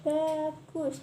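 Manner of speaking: very slow, clear model reading; over enunciating. Shown as a demonstration. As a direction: say it slowly so she can copy. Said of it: "Coba diucapkan lagi".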